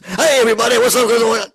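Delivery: loud, high, blown-out shout; loud hyped greeting. Shown as a demonstration. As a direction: yell the greeting into the mic. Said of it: "worrying about doing this".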